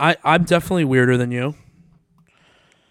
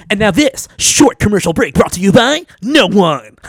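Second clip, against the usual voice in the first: higher, louder mock radio announcer; 6 semitones higher, 8 dB louder than usual. Parodying a radio ad for the podcast. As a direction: boom it out like a commercial break. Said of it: "that was actually really cool".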